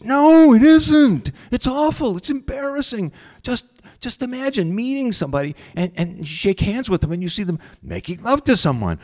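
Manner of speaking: high, loud, agitated outburst; acted anguished, stumbling rant; distressed protest. Seer protests his gift is awful.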